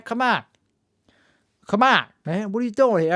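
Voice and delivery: lightly put-on accent, still being learned; Joe Biden. Trying out a Joe Biden impression to start the show, lightly put-on accent. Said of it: "learning the impression of Joe Biden".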